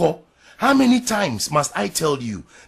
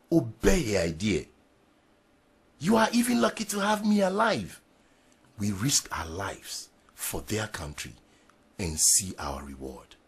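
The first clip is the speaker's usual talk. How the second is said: ordinary delivery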